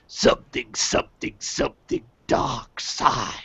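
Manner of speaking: low raspy Sith whisper; Emperor voice. Retold or imitated. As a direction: hiss it like the Emperor. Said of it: "the Emperor came up with".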